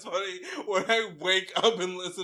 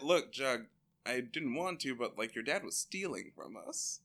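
lower, softer fatherly voice; mock gruff dad, lower and hushed; Fred's voice; 10 semitones lower, 7 dB quieter than usual. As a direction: break it to him gently, low. Voicing Fred to Jughead.

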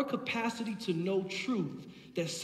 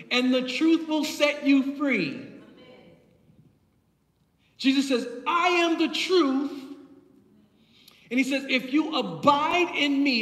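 raised, emphatic pulpit voice; preaching; 6 semitones higher, 6 dB louder than usual. Real, not acted. Preacher declares Jesus's words to congregation, preaching. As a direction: preach it out with punched key words.

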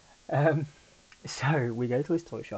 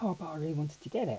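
higher, fast, whiny mock of a disappointed child; imitating upset daughter; 7 semitones higher, faster than usual. Dad imitating his upset daughter's whiny plea, fast and higher. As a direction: play the daughter's sulky plea, lightly. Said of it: "then she got really upset".

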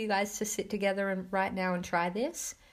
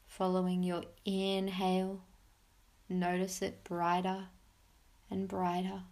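soft spa whisper; 3 dB quieter than usual. Acted. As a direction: guide them gently into calm. Leads a guided meditation.

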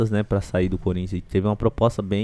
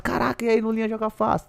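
excited fan voice; 11 semitones higher than usual. Retold or imitated. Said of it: "Eu ia no shopping".

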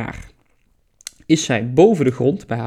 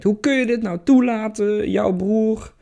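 higher, complaining goddess mother; upset mother voice; 5 semitones higher than usual. Acted. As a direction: plead with him, high and upset. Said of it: "En ze zegt".

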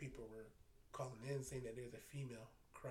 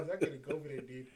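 louder, insistent no; firm refusal; 9 dB louder, faster than usual. Retold or imitated. Refusing his coworker's request, louder and firm. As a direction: put your foot down, loudly.

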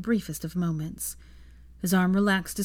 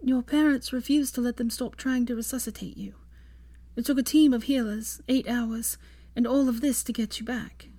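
put-on lightly higher, hushed young woman; Hermione voice; 6 semitones higher than usual. Healer's news told to Draco, lightly higher Hermione voice.

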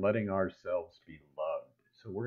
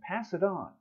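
chipmunk; 8 semitones higher than usual. Acted. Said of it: "the other one hugs it and goes".

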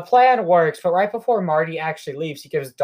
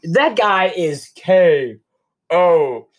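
drawn-out mock fight call; slow, punctuated K.O. announcement; mock knockout call; 4 dB louder, much slower than usual. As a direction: stretch it out, calling a knockout. Calls the knockout punch.

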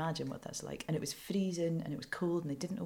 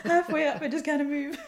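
high, loud, helpless laughter through the words; laughing; 11 semitones higher, 7 dB louder than usual. Real, not acted. Reporter recalling being stuck on a train ladder, laughing as she speaks. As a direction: crack up while telling it.

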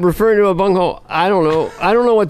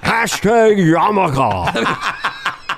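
very slow, drawn-out presidential caricature; Richard Nixon; much slower than usual. Acted. President adding a hashtag, very slow Nixon voice. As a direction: stretch every syllable in a hammed-up impersonation. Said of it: "I do if Nixon had Twitter".

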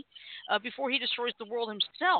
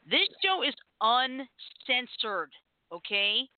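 slow and emphatic; 3 semitones higher, much slower than usual. Real, not acted. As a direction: spell it out slowly. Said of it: "slow emphatic warning".

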